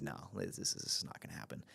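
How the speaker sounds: soft, breathy refusal from the wife; lightly voiced wife, quietly shutting it down; wife vetoing the move under her breath; hushed wife voice. Wife firmly says no.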